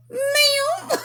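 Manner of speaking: a nervous, high-pitched yelp of surprise; startled squeak. Retold or imitated. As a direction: caught off guard, answering the boss. Startled reply to boss.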